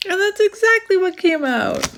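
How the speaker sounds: high, loud, thrilled voice; excited, high and loud. Real, not acted. Delighted the card came out.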